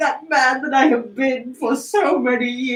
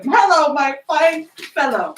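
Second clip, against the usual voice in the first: ordinary talk, nothing marked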